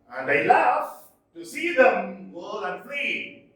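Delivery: love, whirl and flee stressed; anapestic recitation. Shown as a demonstration. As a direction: recite with the beat on each foot. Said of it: "One, two, three feet".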